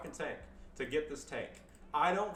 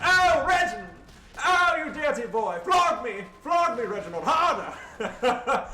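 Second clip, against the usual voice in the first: high, loud camp falsetto, hammed-up; over-the-top mock damsel crying out; mock kinky lady voice; 11 semitones higher, 14 dB louder, slower than usual. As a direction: squeal it like a swooning lady in a farce. Mock kinky role-play voice.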